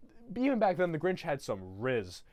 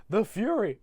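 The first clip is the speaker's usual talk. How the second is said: higher, louder awed gasp; mock awe; 7 semitones higher, 6 dB louder than usual. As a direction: marvel at it. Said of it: "His assistant's like".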